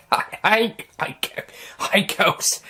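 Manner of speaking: breathless, sputtered hellos; spluttering. A narrator's reading. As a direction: splutter it out, half laughing. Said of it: "splutter a response".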